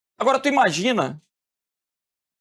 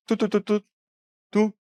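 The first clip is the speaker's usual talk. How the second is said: imitated phone ringing tone, said lightly; phone dial tone. Shown as a demonstration. Teacher imitating a phone ringing for the class, light mock tone. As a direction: hum the ringing line before someone picks up.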